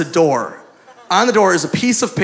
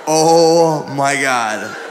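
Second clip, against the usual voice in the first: lower, drawn-out dismay; aghast; 4 semitones lower, much slower than usual. Retold or imitated. Seeing his masseuse come out, slow dismay. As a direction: stretch it out in mock dread.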